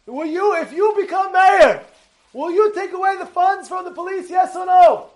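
high, pressing public challenge; demanding crowd. Retold or imitated. Protesters pressing the future mayor for an answer, high and demanding. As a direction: put the question loudly, like a protester. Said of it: "They ask him".

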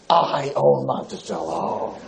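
low, slow, drawn-out threat; menacing club owner. Retold or imitated. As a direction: lean in low and slow like a man who owns the town.

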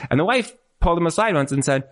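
ordinary talk

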